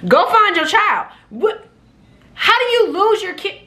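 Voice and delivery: put-on can't believe he lost his kid; incredulous disbelief. Flight attendant telling a father to find his child, incredulous. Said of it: "I said".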